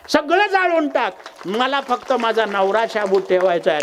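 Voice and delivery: high, emphatic acted prayer; fervent entreaty. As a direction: declare devotion to the fire god. Wife's fervent plea to fire god.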